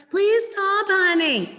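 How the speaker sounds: high sing-song coaxing; pleading, sugary correction. Shown as a demonstration. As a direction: plead sweetly with the dog in a high voice. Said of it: "That's not a correction".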